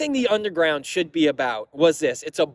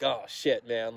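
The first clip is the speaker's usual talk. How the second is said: resigned mutter; 6 semitones lower, 9 dB quieter, slower than usual. Retold or imitated.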